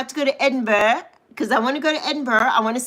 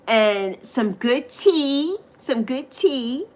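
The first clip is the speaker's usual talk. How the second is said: slow, dreamy; much slower than usual. Real, not acted. Slow drawn wish for tea.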